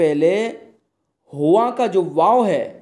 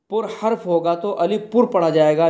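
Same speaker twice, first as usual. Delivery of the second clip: normal talking voice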